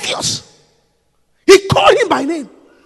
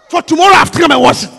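fast, louder brushing-off; fast mock excuse; 5 dB louder, faster than usual. The lazy dishwasher excuses himself, fast and loud. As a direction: rattle it off like an excuse.

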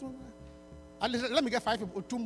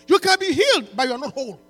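preaching at full force; 9 semitones higher, 11 dB louder than usual. Acted. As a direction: preach it out, stretching each phrase. Preaching over music.

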